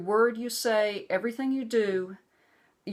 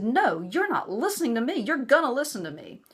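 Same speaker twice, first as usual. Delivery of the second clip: raised, higher angry insistence; retold angry phone reply; mad; 6 semitones higher, 3 dB louder than usual. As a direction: demand he listen, heated. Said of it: "I got mad at that point".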